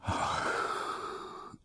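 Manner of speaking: soft whispered sad sigh; king's soft breathy sigh of grief. A narrator's reading. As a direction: breathe out a sorrowful ah. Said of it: "rzekł ze smutkiem król".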